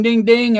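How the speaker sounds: high sing-song ding; imitated bar bell; bell imitation. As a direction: ring it out like a bell.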